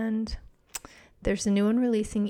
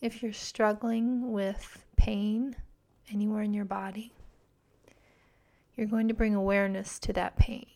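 guided meditation; 4 dB quieter than usual. Acted. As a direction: guide them gently into calm.

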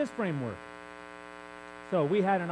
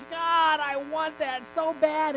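higher, yearning cry to God, drawn out; longing plea; 6 semitones higher, slower than usual. Retold or imitated. Preacher recalling his plea for prophecy, higher and yearning. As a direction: reach up to God wanting it badly.

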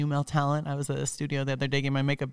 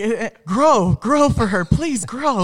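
higher, louder strained pleading voice; mock pleading patient; 6 semitones higher, 6 dB louder, much slower than usual. Patient embarrassed in front of Phoenix, mock pleading. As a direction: beg your own body to cooperate. Patients willing erection for her.

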